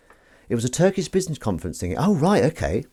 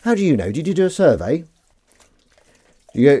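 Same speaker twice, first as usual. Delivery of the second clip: ordinary talk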